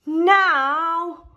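loud, high urgent now; Rolly hopping, desperate for the toilet; urgent cry. Acted. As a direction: yell it, can't wait any longer.

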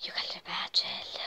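whispered weary aside; whispering. Real, not acted. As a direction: breathe it out, exhausted.

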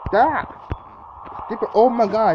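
exasperated yell; frustrated yell. Real, not acted. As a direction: snap at the laggy game. Frustrated at game.

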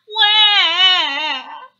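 put-on high, loud baby bawl; acted childish wail; loud mock crying. A narrator's reading. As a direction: burst into a hammed-up wah. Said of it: "Baby Hare started to cry".

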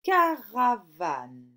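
loud, slow, falling word; slow dictation. Shown as a demonstration. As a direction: dictate it slowly and fully. Teacher dictating words for sound exercise. (dictée lente et appuyée)

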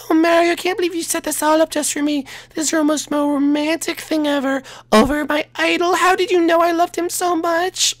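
high, sweet princess, a little snooty. Acted. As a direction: play a high-voiced princess asking teasingly. Daisy character voice.

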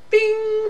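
a sudden very high "bing" like a losing buzzer; ping it out high and sharp, game over; mock bell. Singer telling how they lost the contest, mock buzzer bing.

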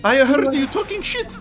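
angry yell. Acted. Character catching a snoop yells in outrage.

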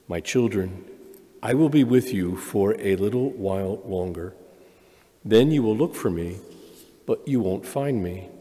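normal talking voice

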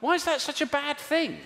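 high, indignant wonder; high puzzled defence; mock innocent question. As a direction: squeak it out, baffled. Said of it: "I always think to myself".

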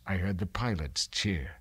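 ordinary delivery

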